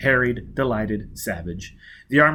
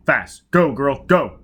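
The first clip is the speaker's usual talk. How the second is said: urgent order; 2 semitones higher, 7 dB louder, much slower than usual. Acted. Foyle urges her off.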